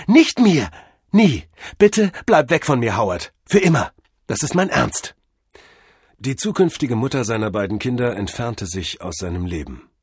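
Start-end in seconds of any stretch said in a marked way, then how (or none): 0.1-5.1 raised, emphatic breakup voice; angry rejection. Acted. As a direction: send him away loudly in short bursts. Mavis angrily breaks up with him. (laut und entschieden abweisend)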